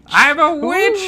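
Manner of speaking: very high, loud screechy witch; creepy witch. Shown as a demonstration. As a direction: shriek it like a cartoon witch. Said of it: "a little creepy".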